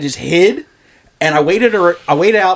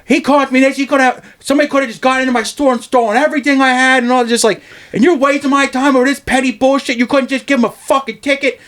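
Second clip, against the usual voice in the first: higher voice, quick angry rant; imitating the boss ranting; 10 semitones higher than usual. Boss cursing out the court for his clerk, imitated higher rant. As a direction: rattle it off like the furious boss defending you. Owner rants at court hearing.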